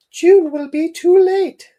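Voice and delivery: very high, loud, slow falsetto mum; hammed-up falsetto, far above your own voice. Mum tells Dad it's hopeless, high falsetto mum voice. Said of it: "I was doing a voice for the mum".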